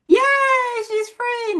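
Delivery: high, loud fake celebration; mock cheer. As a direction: cheer for the character, then undercut it. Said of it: "we're like".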